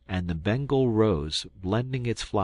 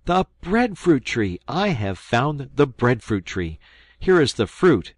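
excited shout; 6 semitones higher, 7 dB louder than usual. Acted. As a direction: call out an amazing find. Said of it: "crying out".

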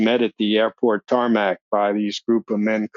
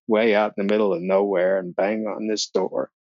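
ordinary delivery

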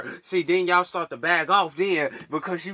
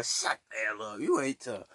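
normal talking voice

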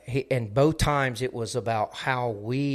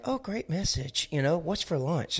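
casual brush-off; 5 semitones higher, 4 dB quieter than usual. Retold or imitated. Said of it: "this was not something that we need to be like".

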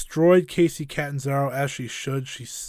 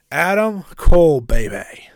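hype call; 2 semitones higher, 12 dB louder than usual. Real, not acted. Hyping the final wrestler.

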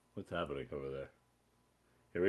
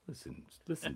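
a hint of a wise TV father; sitcom dad; 3 semitones higher, 12 dB quieter than usual. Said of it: "eighties sitcom dads".

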